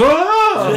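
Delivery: very high, loud mock scream; imitated startled shriek. Friend retelling a video of a startled lady, high mock shriek. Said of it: "and she goes".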